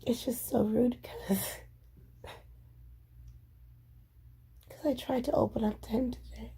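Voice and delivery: unvoiced whisper, holding back. Real, not acted. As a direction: whisper it so no one hears. Whispering venting alone.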